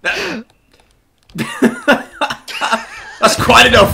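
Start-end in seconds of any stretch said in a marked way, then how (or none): 1.4-3.9 stretched out slowly through laughter; laughing, slow. Real, not acted. To the mutinous soldier, a slow laughing scolding. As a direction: scold while laughing hard.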